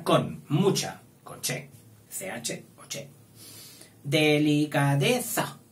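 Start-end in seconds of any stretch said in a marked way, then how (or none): 4.0-5.6 slow, drawn-out dictation word. Shown as a demonstration. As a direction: stretch "Delicadeza" out syllable by syllable. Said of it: "Tal como suena".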